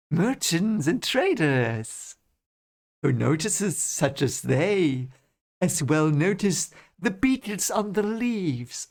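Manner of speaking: contemptuous count, slow with swooping pitch; Barthanes. Acted. Barthanes dismissing merchants to Rand, slow contemptuous count's voice. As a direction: draw out every word in lofty disdain. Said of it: "voice carried equal contempt".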